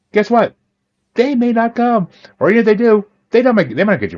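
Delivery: emphatic warning. Real, not acted. Guest warning listeners nobody may come, high and emphatic. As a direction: jump way up to jolt them awake.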